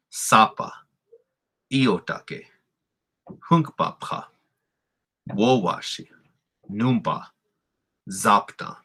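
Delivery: each word from sapa to zapta strong on its first syllable; first-syllable stress. Shown as a demonstration. Teacher asking learners to hear it, first syllables stressed. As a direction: punch SA in sapa, ZAP in zapta. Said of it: "You hear that stress in that first syllable".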